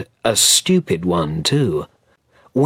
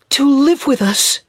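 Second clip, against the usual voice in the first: put-on much higher, louder excited boy's plea; a small boy begging his mother to come; lightly voiced boy, bursting with joy; eager child cry; 4 dB louder than usual. A narrator's reading. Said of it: "he cried".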